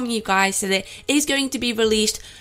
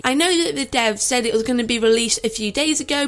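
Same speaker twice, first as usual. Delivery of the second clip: ordinary talk, nothing marked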